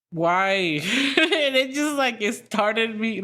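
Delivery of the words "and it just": high bubbling laugh; laughing at her own road rage; real laugh. Real, not acted. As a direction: break into a real giggle. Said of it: "it made me laugh".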